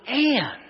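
"and" punched out high and held; stressed "and". Shown as a demonstration. Preacher stressing "and" for the congregation. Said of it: "The most important word, if I didn't emphasize it enough".

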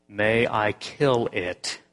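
very slow solemn question; slow, deliberate. Acted. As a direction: draw it out, each word weighed. Angel repeats slowly.

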